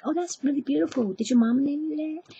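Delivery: high, naive gushing voice; sweetly admiring. Retold or imitated. Girl praising the pimp's name, imitated high sweet voice.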